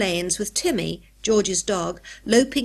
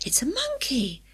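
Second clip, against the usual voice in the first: high, airy cry of astonishment; high-pitched surprise without full volume; astonished; 6 semitones higher, 4 dB quieter than usual. A narrator's reading. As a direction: call it back in amazement. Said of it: "shouted back in astonishment".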